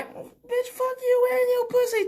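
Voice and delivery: very high squeaky dismissal; high-pitched retort. Acted. Comic snapping back at boastful women, high squeaky retort. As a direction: squeal the insult in a strained high voice.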